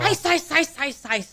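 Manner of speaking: put-on high toddler chant of ice; imitating a two-year-old. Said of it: "So she would say".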